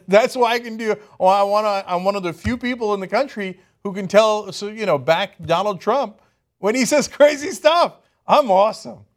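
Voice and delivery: mocking the spokeswoman as proudly oblivious; smug Pearson. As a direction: brag like you missed the joke. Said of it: "she's like".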